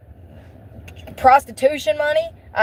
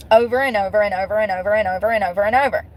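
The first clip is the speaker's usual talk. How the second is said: rapid repetition; faster than usual. Real, not acted. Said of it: "rattles off repetition".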